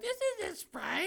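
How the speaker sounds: put-on very high, slow squeaky kid voice; mock kid outrage. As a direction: squeak it like a betrayed little kid. Kid voicing outrage at tonic water.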